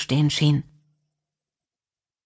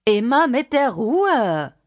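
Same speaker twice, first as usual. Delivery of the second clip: higher, drawn-out call to the door; strong-voiced call; 6 semitones higher, slower than usual. A narrator's reading. Small woman calling to an impatient ringer, strong-voiced. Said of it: "rief die kleine Frau mit kräftiger Stimme".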